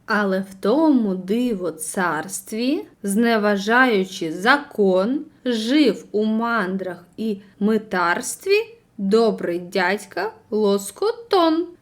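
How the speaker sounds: slow, low storytelling verse read; poem recital. Acted. Teacher reads the tale's verse to the class, low and slow.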